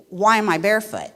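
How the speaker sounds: normal talking voice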